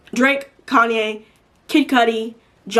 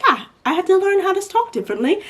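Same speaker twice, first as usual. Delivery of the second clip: put-on rough, much higher try at a British accent; a half-hearted British accent, pitched up high; mock British accent; 10 semitones higher than usual. Fan mimicking actor's real voice, attempted British accent. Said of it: "and then you hear him talk normally".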